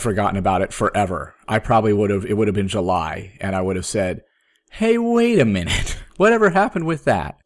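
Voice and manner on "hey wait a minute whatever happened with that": high-pitched mock sudden remembering, a laugh breaking in; sudden realization. Patient imagines remembering the forgotten dialysis, in mock sudden realization. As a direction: act out a belated realization, pitched well up.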